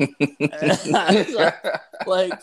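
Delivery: laughing hard through the words; can barely get it out for laughing. Real, not acted. Laughing while retelling the pants story to a friend.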